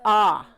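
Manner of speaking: short "Ah" sound, said loud and open; vowel sound demo. Shown as a demonstration. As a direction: model the short o, "Ah". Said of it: "And the letter O".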